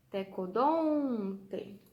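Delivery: "Tecodonte" slowly, DON loud and stretched long; calling the word. Shown as a demonstration. Teacher calling the name to find stress, long loud DON in "Tecodonte". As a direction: call "Tecodonte", holding a swelling DON. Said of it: "Então, é o dom".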